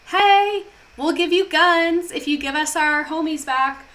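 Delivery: high-pitched, loud Hey into a casual offer; mock casual deal pitch. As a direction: pitch the swap like a buddy. Voices the dealmakers casually offering.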